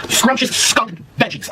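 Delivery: slow, drawn-out naming; slow deliberate announcement of scrumptious skunk veggies; mock-solemn drawl. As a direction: savor each word slowly.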